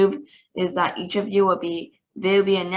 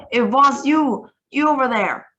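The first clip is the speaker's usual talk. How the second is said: higher, louder, enraged pointing out; furious accusing; 6 semitones higher, 7 dB louder than usual. A narrator's reading. Grand High Witch accuses the foolish witch, furious and louder. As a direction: jab it at the culprit in rage.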